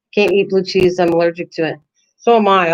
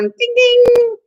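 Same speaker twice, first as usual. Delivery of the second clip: boxing bell; 14 semitones higher, 5 dB louder than usual. Pretend. Announcing round one of mock fight.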